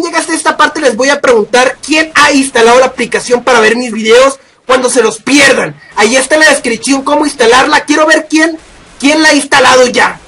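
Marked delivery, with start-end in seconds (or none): none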